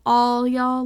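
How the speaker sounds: "all y'all" stressed to include everyone; emphatic all y'all. Shown as a demonstration. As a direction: lean hard on "all" in "all y'all". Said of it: "make more emphasis".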